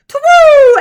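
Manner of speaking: very loud, high "twoo"; flying hoot. A narrator's reading. Albert hooting as he flies, loud hoot. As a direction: hoot loudly while flying. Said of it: "as he flew".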